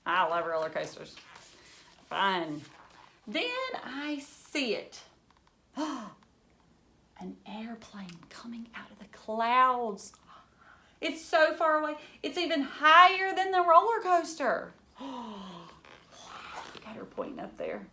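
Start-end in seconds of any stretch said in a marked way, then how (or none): 3.3-5.8 lively storybook reading for a class; reading to children. Acted. Teacher reads picture book aloud. 5.8-10.1 slow breathless whisper; awed whisper. Acted. Hushed sight of a plane. 11.0-14.7 lively storybook reading for a class; reading to children. Acted. Teacher reads picture book aloud.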